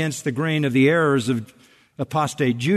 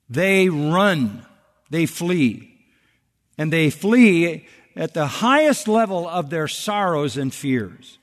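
ordinary delivery

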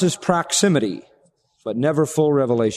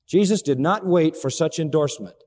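ordinary talk

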